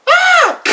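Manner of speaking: sudden high yelp of a scream; mock scream. Acting out the woman's scream in the scene. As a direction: shriek like the startled victim.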